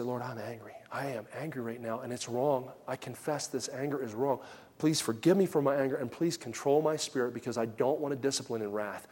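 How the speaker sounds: soft, low, contrite prayer; hushed confession prayer. Retold or imitated. Father praying alone before disciplining, hushed and contrite. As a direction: confess it quietly to the Lord.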